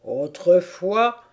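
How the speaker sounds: slow, mildly mournful turtle; lamentable tortue. A narrator's reading. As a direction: draw it out with a touch of lament. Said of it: "après un nouveau et lamentable soupir". (tortue un peu plaintive)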